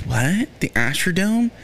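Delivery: can't believe the offer; surprised disbelief. Retold or imitated.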